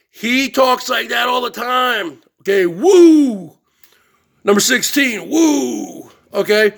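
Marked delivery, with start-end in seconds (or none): 2.4-3.6 mock Ric Flair woo. Wrestler woo call.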